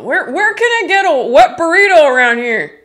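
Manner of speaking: loud high yell across the room; excited yell. Retold or imitated. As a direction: shout it out, demanding a wet burrito. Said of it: "I just remember yelling".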